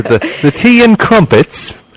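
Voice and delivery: put-on plummy English accent, drawn out for a laugh; mock posh British accent. Teasing the British guest in a mock posh British accent. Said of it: "posh like the Queen".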